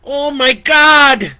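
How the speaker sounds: high, loud, slow terrified yell. Acted. Vicky yells as the killer gets inside. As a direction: cry out in horror. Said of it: "Vicky yelled".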